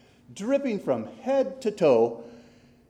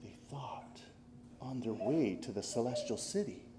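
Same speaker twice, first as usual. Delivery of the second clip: whispered storyteller aside; softly, as if sharing a secret; hushed narration; 5 semitones lower, 10 dB quieter than usual. A narrator's reading. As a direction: drop to a soft hush.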